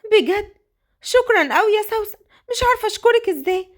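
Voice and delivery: high, eager and grateful; eager thanks. Acted. Orphan Qadr thanking Sosan for taking her in, high eager voice. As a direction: gush with thanks.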